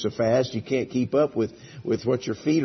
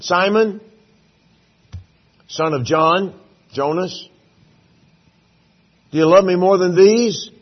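Jesus calling out; 5 semitones higher, 7 dB louder than usual. Acted. Jesus calling Peter by name.

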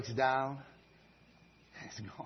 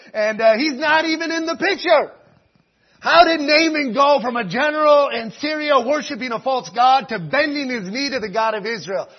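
loud, high, building to a shout; full-force preaching; 13 semitones higher, 18 dB louder than usual. Real, not acted. Preacher stressing the need for men of God, impassioned shouting. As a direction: preach it at the top of your voice.

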